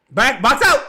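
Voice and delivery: high, loud call to get back; shouted instruction. Real, not acted. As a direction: yell it at the defense. Yelling at players.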